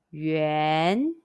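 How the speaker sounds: "Yuan" starting low and rising like a question; "Yuan" on the second tone, rising from low. Shown as a demonstration. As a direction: ask "Yuan?" with a rising pitch. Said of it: "high rising second tone, question tone".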